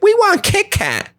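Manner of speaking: put-on very high kid voice. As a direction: pipe up like a greedy trick-or-treater. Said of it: "They're like".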